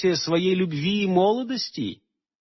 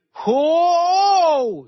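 high loud cry of alarm; high call; 12 semitones higher, 4 dB louder than usual. A narrator's reading. Aleonora crying out as the unicorn rears.

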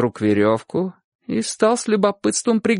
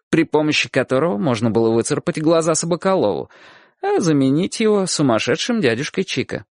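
ordinary delivery

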